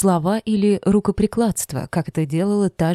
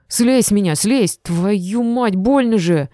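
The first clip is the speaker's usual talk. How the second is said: loud, higher pained yelling; 4 semitones higher, 4 dB louder, slower than usual. A narrator's reading. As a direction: yell in pain to be let go. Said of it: "почему-то закричал". (громкий крик от боли)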